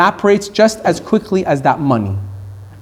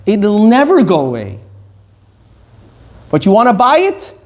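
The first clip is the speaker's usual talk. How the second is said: normal talking voice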